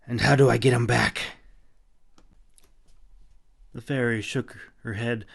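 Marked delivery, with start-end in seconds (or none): 0.0-1.4 louder, insistent question; loud demand. A narrator's reading. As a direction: keep pressing her, louder. Continuing his demand.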